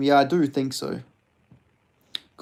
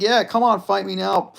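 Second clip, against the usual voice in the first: higher and louder mock challenge; cocky callout; 7 semitones higher, 5 dB louder than usual. Streamer acting out a fighter's cocky callout to Jones. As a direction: taunt him into the fight. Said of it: "He's like".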